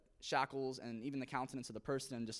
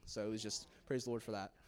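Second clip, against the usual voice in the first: ordinary delivery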